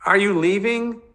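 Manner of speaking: put-on a scared child begging for an answer; crying out in fear. Little brother afraid his big brother leaves, high fearful cry. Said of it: "Joey cried out in fear".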